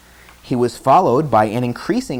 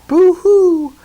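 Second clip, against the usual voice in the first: very high loud fake crying; hammed-up boo-hoo; mock boo-hoo; 8 dB louder than usual. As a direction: wail like little girls. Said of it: "The little girls cried".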